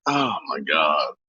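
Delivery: soft, drawn-out oh my God; slow and quiet, half to himself; quiet, slow sigh. Real, not acted. Remembering his father's meatballs, slow and soft.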